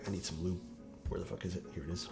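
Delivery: ordinary talk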